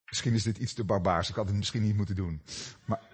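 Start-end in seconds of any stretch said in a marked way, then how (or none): none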